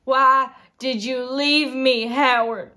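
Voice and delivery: slow, loud, drawn-out demand; anguished cry. Acted. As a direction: cry out to the absent husband, stretching the words. Anguished accusation of abandonment.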